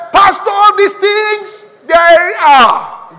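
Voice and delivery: high, slow, full-force acted yell; exultant testimony shout. Church member tells pastor the promises came true, acted exultant shouting. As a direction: bellow each word out in overjoyed amazement.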